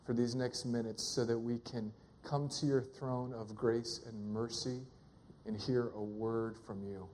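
low, soft, unhurried prayer; hushed, low-pitched pastoral prayer. Real, not acted. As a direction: pray softly and low, pausing between phrases. Leads a prayer.

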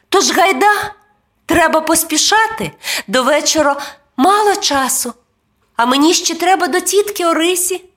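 put-on high, quick child voice; rushed, high-pitched boyish chatter; hurrying boy. A narrator's reading. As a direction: hurry along as an eager little boy. Boy hurries the dog along.

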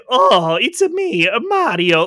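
put-on lightly done Mario Italian accent, slow; a hint of Mario's Italian accent, drawn out; a mild, slowed Mario Italian accent; Mario impression. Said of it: "my man in the game is like".

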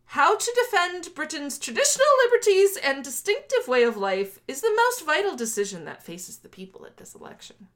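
high, loud oratorical opening, settling down; declaimed quote reading. Acted. Host reading Conservative manifesto to co-host, declaimed. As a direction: proclaim it like a political manifesto.

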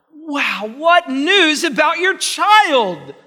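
loud, high, slowed climax of the sermon; full-voiced rising preacher's peak; preaching at full force. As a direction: belt out the joy of the promise.

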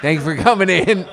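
can't hold the quote straight; retelling while cracking up; laughing through it. Retold or imitated. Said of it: "he's like".

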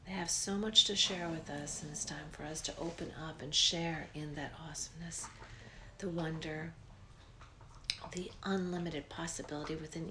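breathy guided relaxation, unhurried; soft spa whisper. Acted. Leads a guided meditation.

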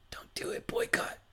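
breathy unvoiced whisper. Shown as a demonstration. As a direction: whisper it conspiratorially. Repeats as a whisper.

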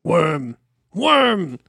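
loud, rising call for a servant. A narrator's reading. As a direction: bark a name across the yard. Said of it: "Saruman called".

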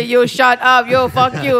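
mock angry shout with a put-on foreign voice; imitating an angry telemarketer. Friend mimics the telemarketer cursing at them, loud mock anger. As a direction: parrot the caller's broken cursing, loud and amused. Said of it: "I love when they say".

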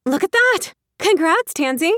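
high, bright-eyed gushing; sweet excited congrats. Acted. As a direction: gush over her like a sweet little sister. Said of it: "smiled sweetly".

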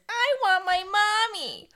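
loud, high, drawn-out whine; over-the-top piercing baby voice; Bill. Acted. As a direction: wail it like a needy owlet. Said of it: "Bill, says".

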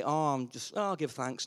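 low, soft, offhand mumble; mock indifferent, quiet and low; half-hearted thanks. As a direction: give thanks as if it hardly matters. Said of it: "You can't say".

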